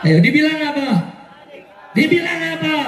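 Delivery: rousing shouted prompt to a crowd; calling out. Real, not acted. Speaker prompting the crowd for the label, calling out. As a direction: demand the answer from everyone.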